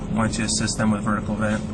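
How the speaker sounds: ordinary talk, nothing marked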